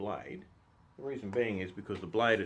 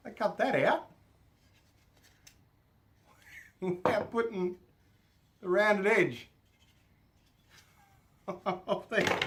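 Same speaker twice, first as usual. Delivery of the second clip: laughing; 11 semitones higher, 9 dB louder, slower than usual. Real, not acted. Laughing at his own mistake.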